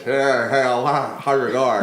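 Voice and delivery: loud goofy put-on voice; new voice. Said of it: "here's my new voice".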